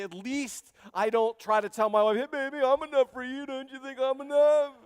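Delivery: high, loud, smarmy husband voice; mock husband line. Husbands imagining themselves saying this, in a high smarmy voice. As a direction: play a smug husband sweet-talking. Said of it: "point to Elkanah and say".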